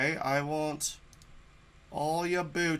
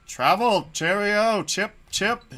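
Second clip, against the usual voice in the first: posh explorer; 6 semitones higher, 7 dB louder, slower than usual. Acted.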